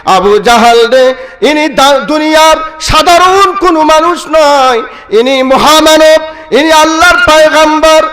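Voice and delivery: high, ringing proclamation; impassioned preaching. Acted. Meccans defy Abu Jahl before taking the kalima, high proclamation. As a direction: declare it in a high, stretched preacher's cry.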